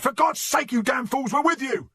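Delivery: high, loud frantic yelling; urgent, outraged battlefield yell; exasperated shouting. A narrator's reading. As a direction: shout at attackers who have the wrong man. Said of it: "he shouted".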